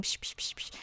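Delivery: mimed whispering with psh sounds. Retold or imitated. Friends whispering beside a sleeping girl, soft unvoiced. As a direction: hush it like friends around a sleeper.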